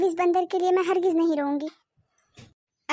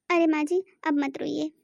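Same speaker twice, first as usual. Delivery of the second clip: usual speaking voice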